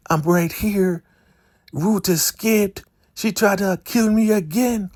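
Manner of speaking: very high, squeaky character voice; put-on Elder Mother. Elder Mother reporting Ruth's attack, high squeaky voice. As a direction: squeal it as a put-upon old cult figure.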